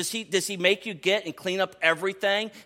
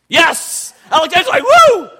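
much higher and louder cheer; excited whoop; 13 semitones higher, 16 dB louder than usual. Real, not acted. Pastor excitedly voicing his son's whoop. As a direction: cheer like the crowd just scored.

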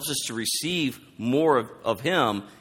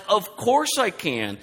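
high, drawn-out "of course"; Jesus, obvious tone; 7 semitones higher, slower than usual. Retold or imitated. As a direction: answer as if it were never in question. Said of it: "So in the tone here, Jesus is like".

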